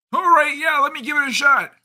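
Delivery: high, loud put-on plumber voice; Mario. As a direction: pitch it way up into cartoon falsetto. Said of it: "Old [name] Mario voice?".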